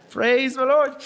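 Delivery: hammed-up hallelujah through a laugh; mock praise. Real, not acted. Pastor jokes to the congregation that the heating is back, slow laughing mock praise. Joking about heating returning.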